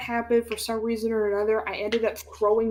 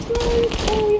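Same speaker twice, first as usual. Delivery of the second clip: much higher, whiny fake-tearful sorry; fake sobbing apology; 13 semitones higher, 4 dB louder than usual. Caught by teacher, faking tearful apology. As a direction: fake cry to get out of trouble. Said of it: "put on some fake tears".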